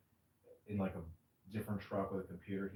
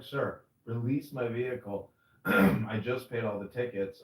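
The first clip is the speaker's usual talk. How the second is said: mock formal demand; 3 semitones higher, 9 dB louder, slower than usual. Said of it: "I'm like".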